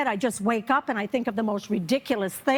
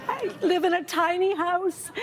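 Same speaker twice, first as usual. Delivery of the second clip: mock crying clerk; 5 semitones higher than usual. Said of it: "Well, then she starts crying".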